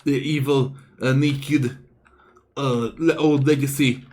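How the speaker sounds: put-on rally the troops in an improvised Dynasty accent; hammed-up Dynasty accent, monk giving orders; made-up dynasty accent. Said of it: "how do I do a dynasty accent".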